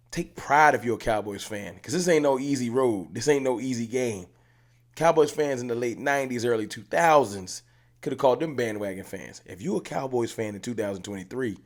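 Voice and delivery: usual speaking voice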